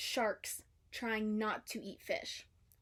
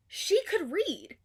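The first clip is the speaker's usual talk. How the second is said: delighted discovery; 8 semitones higher, 6 dB louder, slower than usual. A narrator's reading. Narrator voicing Dory's realisation.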